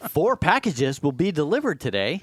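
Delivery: mock perky announcement, swooping up and down; singsong, over-keen delivery; fake excitement. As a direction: imitate an overexcited voice assistant. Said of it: "fake excitement".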